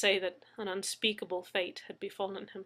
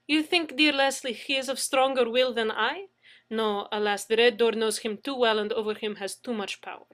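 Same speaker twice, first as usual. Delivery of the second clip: mocking Russian accent; 2 semitones higher, 7 dB louder than usual. A narrator's reading. Anna teases Leslie, Russian accent. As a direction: tease him fondly.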